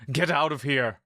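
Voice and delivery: shout. Acted. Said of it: "Ernog shouts".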